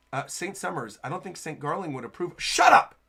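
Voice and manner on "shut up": sudden high, loud outburst; acted burst of irritation; angry shout. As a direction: shut them down with a loud snap. Acting an angry character's interruption.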